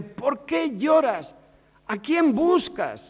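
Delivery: slow, drawn-out questioning; slow and insistent. Acted. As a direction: press the question gently, word by word.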